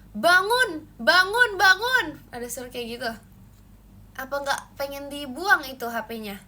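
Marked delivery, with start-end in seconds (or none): 0.1-2.1 loud, higher repeated "bangun"; loud wake-up call. Retold or imitated. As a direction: rouse a sleeper like an alarm. (teriakan bangun yang keras dan tinggi)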